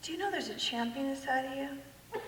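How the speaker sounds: pastor addressing her. Retold or imitated. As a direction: drop to a soft, pointed question. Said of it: "he looks at me and goes".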